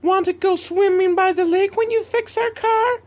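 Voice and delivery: very high, sugary fake-innocent girly voice; helpless girls. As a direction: hams up the helpless damsel, way up high. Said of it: "They're like".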